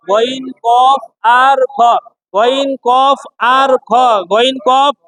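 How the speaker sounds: "গইন, কফ আর খ" slow, with rounded o vowels; heavy letter pronunciation. Shown as a demonstration. Teacher leading students' repetition, slow heavy "গইন, কফ আর খ". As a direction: stretch "গইন" and "কফ", keeping them heavy. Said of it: "উচ্চারণটা মোটা হবে".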